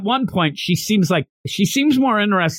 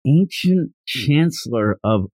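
ordinary talk

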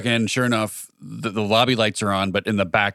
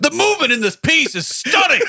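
very high, loud mock appreciation; aggressive art praise; 5 dB louder than usual. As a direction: shout the art critique like a tic. Aggressive gallery comment acted.

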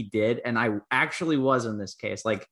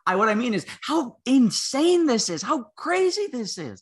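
incredulous; 10 semitones higher, 3 dB louder than usual. Real, not acted. Amazed by the scandal.

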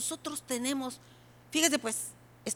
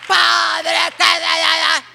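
much higher and very loud, stammering; loud prayer demo; 5 semitones higher, 14 dB louder, much slower than usual. Shown as a demonstration. Preacher demonstrating loud night prayer, shouting. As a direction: cry out to God at full volume. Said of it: "le voy a decir cómo".

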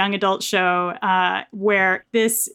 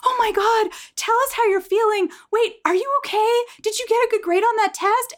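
put-on high, eager voice of caring boy band; gushing boy band; faster than usual. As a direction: gush over her like a doting boy band, pitched up. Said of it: "her boy band is like".

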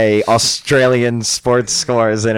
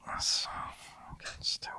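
hushed whisper, half laughing; whispered aside; 20 dB quieter than usual. Real, not acted. Host mocking the phone's answer in a whisper. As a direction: whisper it so the phone won't hear.

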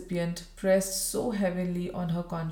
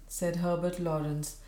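ordinary delivery, nothing marked

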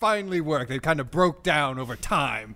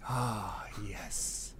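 whisper; 7 semitones lower, 13 dB quieter than usual. Acted. Seductive whisper about making love.

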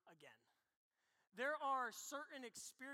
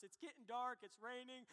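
ordinary talk

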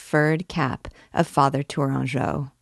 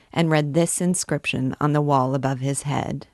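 usual speaking voice

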